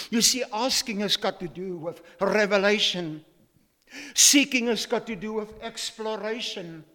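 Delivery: rising sermon emphasis; emphatic preaching. Acted. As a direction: preach it out to the congregation. Preaching the key point.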